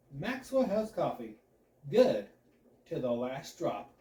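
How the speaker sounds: slow read with "Good" punched very loud; confident pro delivery, big hit on "Good"; nailed ad read. Acted. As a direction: slam "Good", then glide to the end. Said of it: "Booyah! Nailed it".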